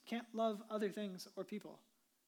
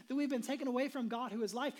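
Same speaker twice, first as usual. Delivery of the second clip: ordinary talk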